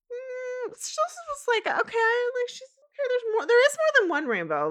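exasperated squeal. Real, not acted. Exasperated rant about Pinkie.

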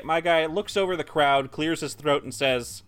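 usual speaking voice

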